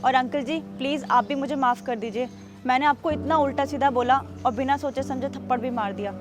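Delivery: quietly owning up to a big mistake; remorseful apology. Acted. Woman softly begs the guard and neighbour for forgiveness, remorseful.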